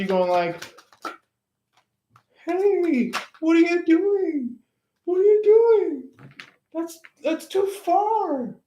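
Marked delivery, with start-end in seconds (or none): 2.4-8.6 much higher, squeaky panicked calling out; mock frantic ex. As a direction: chase after them in a whiny high voice. Third party chasing after you.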